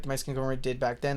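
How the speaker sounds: ordinary talk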